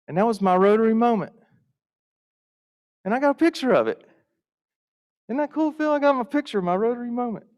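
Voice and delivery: high, fast and thrilled, showing off the photo; bursting with pride, pitch way up; excited. Real, not acted. Speaker to Phil about his Rotary moment, thrilled and high. Proud climax shown to Phil.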